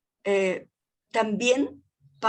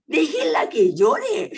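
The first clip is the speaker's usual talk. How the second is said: slow, higher and louder, half-shouted; retold angry outburst; 7 semitones higher, 7 dB louder, slower than usual. At the cemetery, defending the crying granddaughter: a slow, loud outburst. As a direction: insist hard, dragging each word out. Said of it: "Yo peleando con el mundo en el cementerio".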